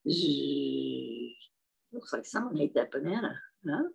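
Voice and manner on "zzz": low, droning gorilla zzz; long low pretend snore; gorilla's sleeping buzz. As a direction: snooze like a gorilla. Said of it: "what does the gorilla say".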